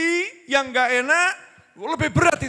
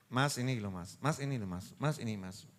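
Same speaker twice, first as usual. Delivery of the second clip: hushed, softer murmur to the husband; whispering to husband; 11 semitones lower, 10 dB quieter than usual. Retold or imitated. Preacher voicing wife whispering to her husband, hushed. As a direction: coax him in a low hush. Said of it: "Suaminya dibisik".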